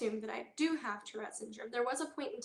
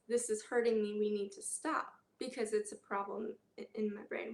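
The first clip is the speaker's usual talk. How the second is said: ordinary delivery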